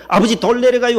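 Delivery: quick high call. Retold or imitated. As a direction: shout the warning fast as practiced. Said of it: "병원에서 연습한 대로". (연습한 대로 아들이 아버지에게 높고 크게 빠르게 외침)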